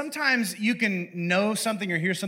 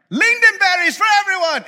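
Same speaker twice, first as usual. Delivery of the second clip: hammed-up heavenly choir; grand Swedish angels; 14 semitones higher, 8 dB louder than usual. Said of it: "Swedish angels voiced".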